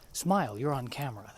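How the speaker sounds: ordinary talk, nothing marked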